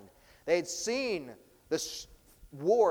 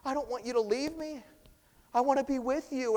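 pleading man; 10 semitones higher, 5 dB louder than usual. Retold or imitated. Healed man pleading with Jesus.

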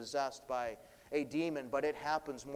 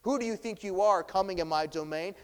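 defiant demon; 5 semitones higher, 8 dB louder than usual. Shown as a demonstration. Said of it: "it's hard to tell from this first tone".

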